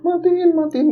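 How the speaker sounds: panicked cry. Retold or imitated. Terrified daughter crying for the light to be switched off, very high and panicked. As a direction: shriek to turn it off. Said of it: "nangisnya lebih kencang lagi".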